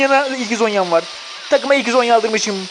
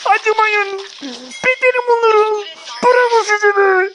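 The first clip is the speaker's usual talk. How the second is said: excited cheering; 11 semitones higher, slower than usual. Real, not acted. Cheering teammates on.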